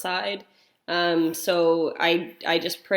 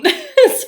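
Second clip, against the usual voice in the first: high, loud burst of laughter; sudden high giggle burst; 10 dB louder than usual. Real, not acted. Laughing at the mix-up.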